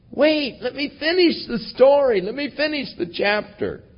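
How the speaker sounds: God speaking. Acted. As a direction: lightly voice God reassuring his child. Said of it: "when God says".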